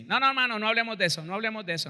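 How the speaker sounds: offended brother refusing the apology; curt angry refusal. Retold or imitated. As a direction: cut off the apology sharply.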